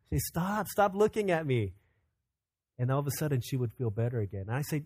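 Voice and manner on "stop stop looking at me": higher-pitched child's mock protest; little girl protesting playfully. Daughter to her teasing dad, high playful protest. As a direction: lightly voice a small daughter, pitched up. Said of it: "fighting to try and stop a smile".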